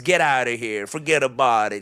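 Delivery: a lightly voiced New York doctor brushing it off; dismissive New York "forget about it"; New York accent. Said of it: "in New York terms".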